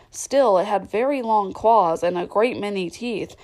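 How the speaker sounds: plain reading voice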